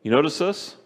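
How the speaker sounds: normal talking voice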